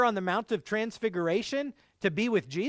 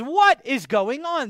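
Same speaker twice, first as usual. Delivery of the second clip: louder, higher, drawn-out mock outcry; retold crying out; 5 semitones higher, 7 dB louder, slower than usual. Witch losing it as the conjuring works, crying out. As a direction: imitate a startled woman crying out, stretched and raised. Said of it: "She cries out and she goes".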